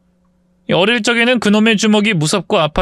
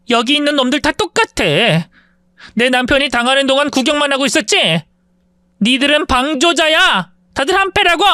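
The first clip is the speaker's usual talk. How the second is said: shouting at classmates; 9 semitones higher than usual. Acted. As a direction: scold the whole room. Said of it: "동창들에게 소리쳤습니다".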